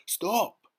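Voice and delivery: high, loud shout of stop; panicked cry. A narrator's reading. The earthworm cries out to stop James's plan. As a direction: yell it in panic. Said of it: "cried the earthworm".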